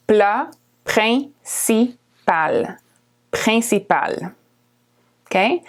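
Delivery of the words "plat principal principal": plat principal drawn out slowly; slow model. Shown as a demonstration. Teacher slowing plat principal, careful. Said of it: "The I N is a nasal vowel".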